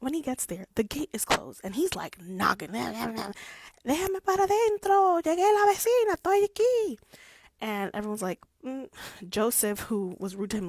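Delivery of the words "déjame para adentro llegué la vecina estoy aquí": much higher, louder pleading voice; comic Spanish plea. Retold or imitated. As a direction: beg to be let in. Lockwood knocking at the gate.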